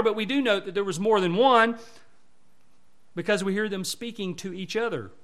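ordinary talk

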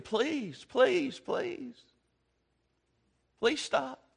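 mock childish begging, soft and pleading; whiny plea. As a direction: beg like a little kid asking to stop. Mocking Hezekiah's whiny plea.

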